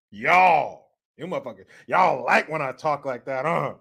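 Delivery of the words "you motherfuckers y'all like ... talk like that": usual speaking voice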